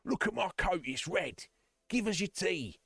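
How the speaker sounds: high-pitched, hammed-up invader's taunt; mock imperial bully. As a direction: crow it like a rowdy mock conqueror. Acting out British Empire boasting.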